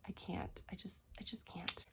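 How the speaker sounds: breathy whisper, giving up; exasperated whisper. Real, not acted. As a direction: whisper it like you're fed up. Exasperated about smelly lipsticks.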